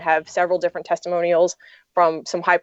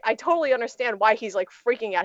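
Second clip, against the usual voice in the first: ordinary talk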